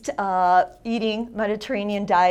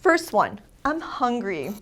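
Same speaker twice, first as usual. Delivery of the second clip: usual speaking voice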